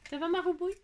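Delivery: high, doting cat-owner voice; sweet talk to a pet; cooing pet talk. Real, not acted. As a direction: tease a sunbathing cat affectionately in a high lilt. Talking to her cat.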